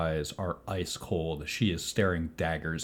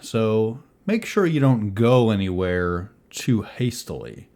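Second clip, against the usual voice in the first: louder, firm officer giving orders; officer addressing the group; 4 semitones higher, 7 dB louder than usual. Acted. Officer warning the lot of them not to leave, loud and firm. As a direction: tell them firmly to stay put.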